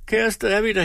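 lightly voiced weeping woman; crying. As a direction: beg through mock tears. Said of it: "nu græd Tripstine".

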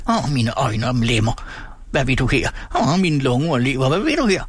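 put-on whining old ogre of a shopkeeper, rattle in the throat; greedy monotone whine. A narrator's reading. Dreadful old man confronts the frightened boy, whining greedily. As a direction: wail each cry, ending in a throaty rattle. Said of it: "glupsk, monotont vin".

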